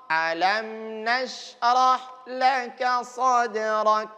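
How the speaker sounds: slow recitation, lips moving only on the m; careful lip movement. Shown as a demonstration. Teacher shows lip movement, slow. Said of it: "Perhatikan mulutnya".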